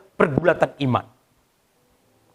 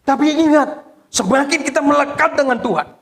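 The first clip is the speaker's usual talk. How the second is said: high, raised pulpit voice; preaching exhortation; 8 semitones higher than usual. Real, not acted. Pastor reminding congregation to stay sharp, high forceful preaching. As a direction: press the point home urgently.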